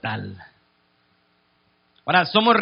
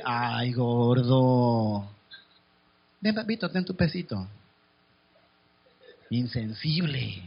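softer, slow cooing "ay, gordo"; sweet coaxing wife; 6 semitones lower, 5 dB quieter, slower than usual. Acted. A wife coaxing her husband home, soft and slow cooing. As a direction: coax him in gently, like a doting wife. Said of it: "Y la mujer podría decir".

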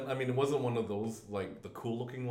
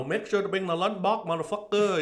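high, loud mock Vietnamese parent; Vietnamese accent; 9 semitones higher, 8 dB louder than usual. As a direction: nag the kid in a put-on Vietnamese accent. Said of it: "talk to my kids with a Vietnamese accent".

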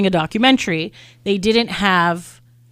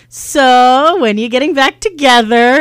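loud, drawn-out retold question; pointed question; 4 semitones higher, 8 dB louder, slower than usual. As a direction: put it to them slowly and loudly. Retells her bold question to Lance.

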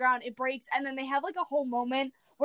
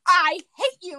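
mock bratty outburst; 5 semitones higher, 7 dB louder than usual. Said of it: "She goes".

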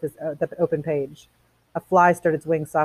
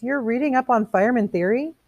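higher, rising, incredulous question; puzzled question; 8 semitones higher than usual. Acted. Missus Phelps questioning the fireman's book, high and puzzled. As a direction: ask in surprised disbelief.

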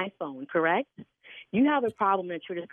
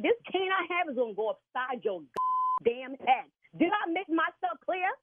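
furious outburst; 3 semitones higher than usual. Real, not acted. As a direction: let the temper blow, shouting threats. Signer explodes at prank caller.

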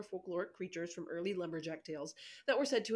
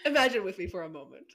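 storytelling voice; 7 dB louder than usual. Acted. Begins a spoken tale.

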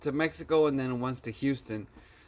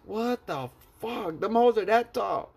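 amazed; 6 semitones higher than usual. Real, not acted. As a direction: stretch it out, amazed. Shocked at tall mountains.

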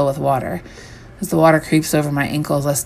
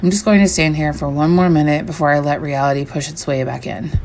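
usual speaking voice

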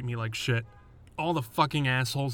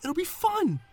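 much higher, fast, unhinged sing-song; manic taunt; 8 semitones higher than usual. Retold or imitated. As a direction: taunt with a crazy glee. Doorman presses manic threat.

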